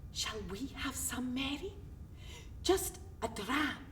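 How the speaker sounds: soft, conspiratorial invitation; hushed offer. Acted. Friend tempting Mary with a dram, hushed. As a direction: drop your voice to tempt a friend.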